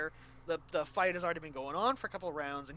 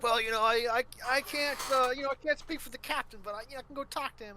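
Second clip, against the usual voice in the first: character voice; 8 semitones higher, 4 dB louder, faster than usual. Retold or imitated. Raku character voiced in story.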